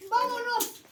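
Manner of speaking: loud, high shout of come on; an eager, rallying call to go. Acted. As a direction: yell it to pull your brother along. Brothers pulling each other along.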